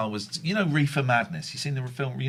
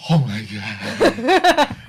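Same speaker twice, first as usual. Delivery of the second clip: slow stoned gasp; 8 dB louder, much slower than usual. Retold or imitated. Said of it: "people going".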